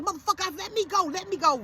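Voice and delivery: high-pitched show of fury; mock struggle. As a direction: pretend you're being held back. Acts out pretending to be held back.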